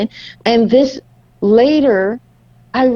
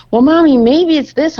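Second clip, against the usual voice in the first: put-on a light child's voice, higher and louder; eager child; 8 semitones higher, 5 dB louder than usual. Mother voicing her child offering an idea, eager and higher. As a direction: pipe up like an eager little kid.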